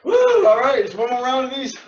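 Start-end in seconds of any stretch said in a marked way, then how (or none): 0.0-0.5 loud, high cheering whoop; excited whoop. Real, not acted. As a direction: whoop for joy at finishing. Cheering at end of set.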